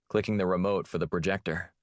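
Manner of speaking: usual speaking voice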